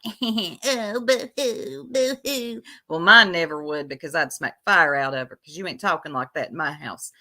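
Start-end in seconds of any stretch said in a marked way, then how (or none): 0.0-2.6 high, whiny fake boo-hoo; mock crying. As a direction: imitate a teenage girl's babyish crying. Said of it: "she's crying like".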